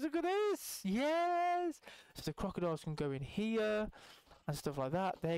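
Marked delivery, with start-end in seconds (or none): 0.0-1.8 high, elated cheer; excited. Real, not acted. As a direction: show it off, overjoyed. Delighted with the result.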